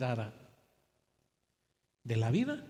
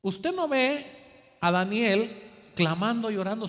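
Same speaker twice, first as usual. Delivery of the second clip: predicación enfática; 4 semitones higher, 6 dB louder than usual. Real, not acted.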